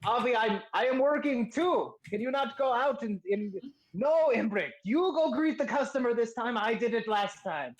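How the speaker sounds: high-pitched bickering shopkeeper; calling back. Acted. Shopkeeper tells partner to greet customer, bickering call. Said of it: "you hear the voice call out".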